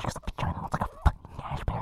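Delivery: hushed crude whisper; whispered crude explanation. Real, not acted. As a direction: whisper it like a secret. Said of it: "so loud no one else can be audible".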